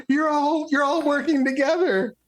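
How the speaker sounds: very high, panicked cry; panicked scream. Acted. Game master as terrified man accusing the party, screamed high. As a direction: squeal it in a held high pitch.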